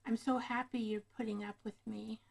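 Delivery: normal talking voice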